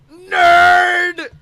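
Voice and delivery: a sudden high full-throated yell; shouted catchphrase. Acted. A shouted catchphrase when the host demands the word. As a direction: bellow the word all out. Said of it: "give him the word".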